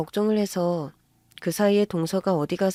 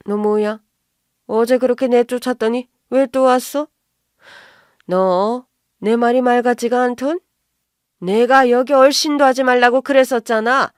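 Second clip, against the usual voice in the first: angry, loud, higher-pitched scolding; mother-in-law yelling at an unwelcome daughter-in-law; 4 semitones higher, 7 dB louder than usual. Acted. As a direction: bawl them out at full volume. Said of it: "그렇게 고래고래 소리 안 쳐도".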